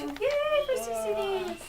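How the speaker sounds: high, drawn-out yay; excited cheer. Real, not acted. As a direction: cheer slowly in a high squeal. Teacher cheering along with pupils.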